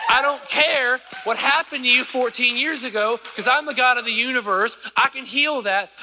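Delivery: high, loud preacher voicing God; God speaking through the preacher; preaching. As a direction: lift the congregation as God's promise.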